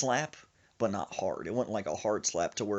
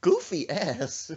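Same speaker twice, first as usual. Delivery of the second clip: teasing; 10 semitones higher, 6 dB louder, much slower than usual. Retold or imitated. Friend's teasing catchphrase.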